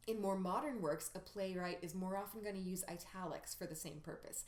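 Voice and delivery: normal talking voice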